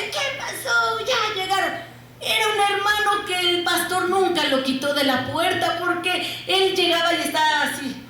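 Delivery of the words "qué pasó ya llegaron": higher, laughing friendly greeting; welcoming brother. Retold or imitated. The loving brother greets the children at the door, high and laughing. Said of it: "el hermano nos recibía".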